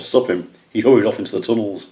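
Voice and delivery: usual speaking voice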